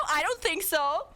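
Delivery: ordinary delivery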